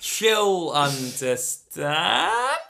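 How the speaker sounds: very slow, stretched-out words; hammed-up, drawn-out doubtful assurance; mock reassurance. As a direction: drag it out as if unconvinced. Joking false confidence about girlfriend.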